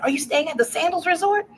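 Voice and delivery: light imitation of an eager rider; passenger voice.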